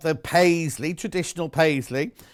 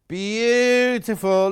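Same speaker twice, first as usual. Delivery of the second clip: slow, dreamy, higher praise; drawn-out beautiful; 5 semitones higher, 4 dB louder, much slower than usual. Real, not acted. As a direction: linger on every sound of it. Drawn-out admiration.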